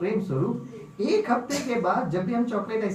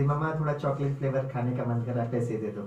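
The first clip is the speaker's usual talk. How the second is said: put-on soft, lower child coaxing mother; sweetly coaxing; 7 semitones lower, 3 dB quieter than usual. Girl to her mother, asking money for ice cream, sweetly coaxing. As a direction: wheedle mama lovingly for money. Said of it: "बहुत प्यार से बोलती".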